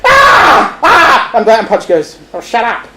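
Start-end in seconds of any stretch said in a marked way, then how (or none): 0.0-1.3 very high mock baby wail; imitated infant bawling; baby crying. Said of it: "then the baby starts crying". 2.3-2.9 softer first telling-off; irritated mutter. Acted. Punch answers crying baby.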